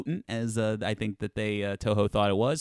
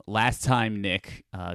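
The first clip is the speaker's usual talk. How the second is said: normal talking voice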